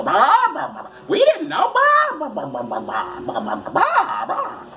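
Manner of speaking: high wailing blabber that drops and rises; mock Mountie. Mountie pleading they didn't know, mock blabbering voice. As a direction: honk out the blahs in a silly swooping voice. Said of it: "That's how the Royal Canadian Mounted Police talk".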